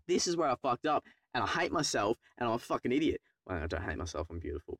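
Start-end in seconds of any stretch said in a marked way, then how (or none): 3.5-4.8 quiet aside. Real, not acted. Self-mocking aside.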